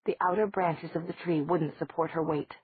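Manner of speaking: usual speaking voice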